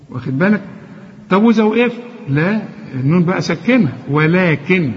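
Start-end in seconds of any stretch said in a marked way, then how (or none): none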